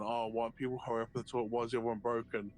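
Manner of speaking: usual speaking voice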